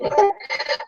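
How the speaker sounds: high wheezy coughing laugh; cough, then gasp out a laugh; choking cough and laugh. Real, not acted. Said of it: "that water went down the wrong pipe".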